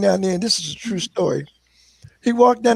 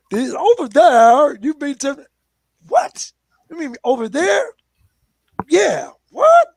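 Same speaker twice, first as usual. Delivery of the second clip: confused outsider; 5 semitones higher, 3 dB louder than usual. Retold or imitated. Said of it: "they had the Southern accent".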